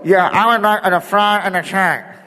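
high, garbled speaker voice; drive-thru speaker. Acted. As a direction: imitate a garbled order through a bad speaker. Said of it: "as awful as they sound to you coming through that speaker".

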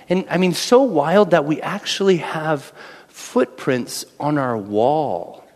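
normal talking voice

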